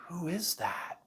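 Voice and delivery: hushed, unvoiced whisper; under her breath. Girl asking about the dark figure, really quiet whisper. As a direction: whisper it, barely audible. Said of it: "Just under her voice, like, really quiet".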